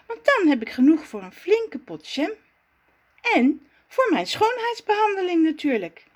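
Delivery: put-on high, chatty girlish voice, pleased with her plan; Zaza's voice. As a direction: play the vain little Zaza dreaming of beauty. Zaza's character voice. (hoge, tevreden stem van Zaza)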